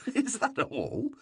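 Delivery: laughing, slow and high; amused laugh. Acted. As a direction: laugh it off in disbelief. King laughs at the answer.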